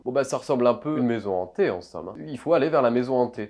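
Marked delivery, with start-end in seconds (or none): none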